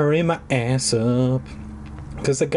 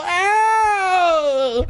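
long, very high wail; hammed-up drawn-out howl of pain; 4 dB louder than usual. Cry from the struggle with the dummy, pained wail.

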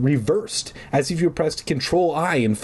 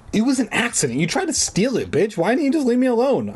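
high-pitched, indignant protest; mild shout; 11 semitones higher, faster than usual. A narrator's reading. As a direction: shout it high without much volume, rushing the end. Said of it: "I shouted".

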